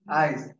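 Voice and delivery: আইজ said in dialect, single syllable; dialect aij. Shown as a demonstration. Teacher demonstrating dialect আইজ to students. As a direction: say আইজ the regional way, not আজি. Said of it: "খেয়াল করো".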